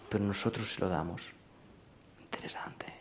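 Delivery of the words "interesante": breathy whisper, almost to himself; a soft muttered whisper of interest; whispered aside. Real, not acted. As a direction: whisper it like a private thought.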